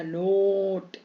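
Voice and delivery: "नोट" with the ओ held long; stretched long o. Shown as a demonstration. Teacher showing what added e does, long o. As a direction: draw out the o in "नोट" before the t. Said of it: "नो पे खींचेगा".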